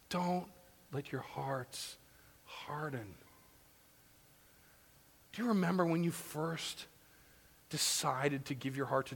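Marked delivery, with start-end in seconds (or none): 0.1-3.1 much softer, slow, earnest; solemn plea. Acted. Preacher urging the congregation, soft earnest plea. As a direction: say it gently, almost pleading. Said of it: "solemn plea after story".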